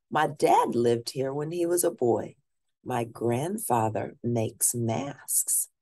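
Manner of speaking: usual speaking voice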